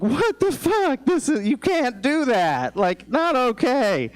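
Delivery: high, slow, stunned disbelief; shocked outburst. Retold or imitated. Waking to a naked woman in his bed, high shocked protest. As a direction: drag it out, high and appalled.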